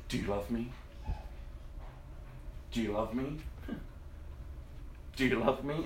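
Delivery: soft, low, searching question; gentle asking. Acted. God asking the listener about love, gently. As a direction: ask it softly, leaving space. Question repeated softly to congregation.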